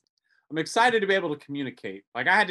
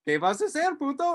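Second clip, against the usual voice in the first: innocent repeat; 10 semitones higher than usual. Retold or imitated. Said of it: "I walk up to that guy and go".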